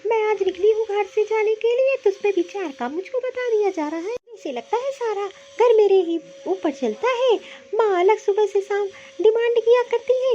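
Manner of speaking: building from irritated to a loud, furious complaint; resentful daughter-in-law rising to a shout; angry outburst. Acted. Wife's angry outburst at husband.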